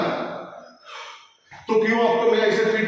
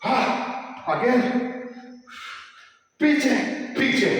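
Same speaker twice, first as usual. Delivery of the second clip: raised coaching cue; coach's call; 6 semitones higher, slower than usual. Real, not acted. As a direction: call it out to the class. Coach calling out during workout.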